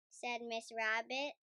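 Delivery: plain reading voice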